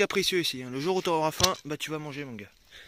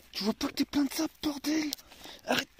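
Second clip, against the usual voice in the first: higher, exasperated telling-off; scolding the dog; 8 semitones higher than usual. Real, not acted. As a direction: tell the wet dog off.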